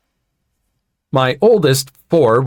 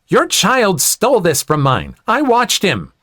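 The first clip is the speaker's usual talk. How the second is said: higher-pitched, lightly voiced entitled mother; acted accusation; 8 semitones higher than usual. A narrator's reading. Entitled mother to the boy's mother, accusing.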